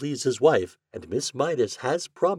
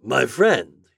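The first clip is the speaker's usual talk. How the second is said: proud; 4 semitones higher, 9 dB louder than usual. Acted. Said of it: "he began proudly".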